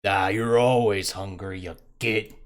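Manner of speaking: loud, slow monster grumble; scolding monster. Acted. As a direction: scold a hungry companion loudly and slowly. GM voices cook scolding hungry monster.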